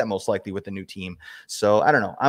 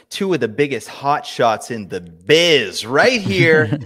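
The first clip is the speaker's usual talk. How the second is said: hammed-up announcer hype; 3 semitones higher, 8 dB louder, much slower than usual. Hyping up the two hosts.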